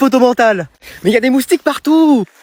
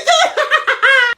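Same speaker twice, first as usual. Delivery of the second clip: high mocking laugh.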